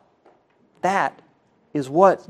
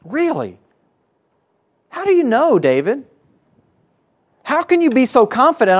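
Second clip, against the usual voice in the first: incredulous question; 6 semitones higher than usual. Pretend. Preacher challenges David incredulously.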